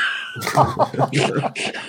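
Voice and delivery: high, airy burst of laughter. Real, not acted. Game master reacts to the bad roll, laughing. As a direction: crack up with a high giggle.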